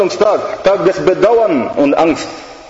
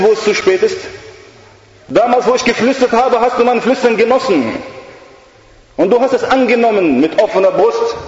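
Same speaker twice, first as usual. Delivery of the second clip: higher, taunting devil voice; mocking devil; 6 semitones higher than usual. The devil mocking the dying girl, higher and scornful. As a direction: sneer at her too-late regret. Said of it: "Der Teufel sagt zu ihr".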